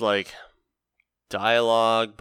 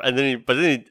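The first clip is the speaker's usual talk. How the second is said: ordinary delivery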